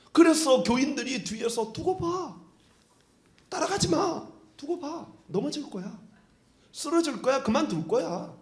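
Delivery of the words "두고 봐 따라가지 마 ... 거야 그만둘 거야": mocking. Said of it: "그렇게 조롱하는 사람들이 있습니다".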